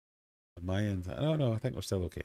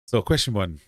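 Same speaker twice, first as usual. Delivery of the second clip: usual speaking voice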